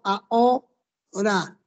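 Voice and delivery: drawn-out "Ahora", a and o as separate beats; syllables pronounced apart. Shown as a demonstration. Teacher repeating the hiatus for students, "Ahora" slowly split a-o-ra. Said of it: "ya está separado".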